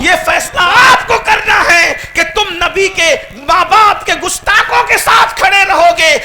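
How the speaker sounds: high, loud, building sermon cry; fiery preaching. Acted. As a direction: preach it to the rafters, rising each line. Preacher challenging the crowd.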